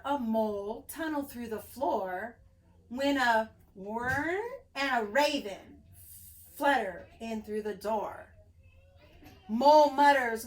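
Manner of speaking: ordinary delivery, nothing marked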